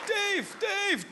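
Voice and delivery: high excited call. Retold or imitated. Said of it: "I sound like Monica Lewinsky".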